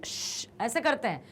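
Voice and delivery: ordinary talk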